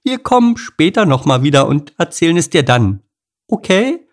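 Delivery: high, coaxing animal voice; smooth it over, rising on okay; nervous appeasing chatter; placating character voice. Acted. Henriette retreating.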